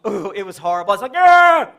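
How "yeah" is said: sudden loud high yelp. Retold or imitated. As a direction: blurt it out with a yelp. Reacting with crayon in nose.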